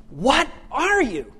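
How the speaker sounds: slow, high, drawn-out question; amazed onlookers. Acted. People say it about Superman, slow astonished question. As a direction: marvel at it, stretching each word. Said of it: "caused people to say about Superman".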